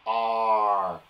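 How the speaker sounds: ordinary delivery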